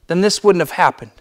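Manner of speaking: loud, high, impassioned preaching; fervent pulpit voice, pleading with God; preaching at full force. Acted. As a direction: pour it out from the pulpit. Preacher retelling Martha's lament.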